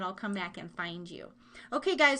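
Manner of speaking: normal talking voice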